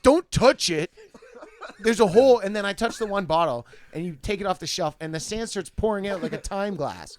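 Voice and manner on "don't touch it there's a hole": urgent warning. Retold or imitated. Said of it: "And he's like".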